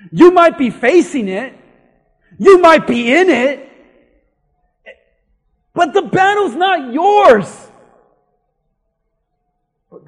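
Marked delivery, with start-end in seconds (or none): 0.1-7.7 loud, emphatic pulpit reassurance; forceful preaching. Acted. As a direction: drive it home loudly to the congregation.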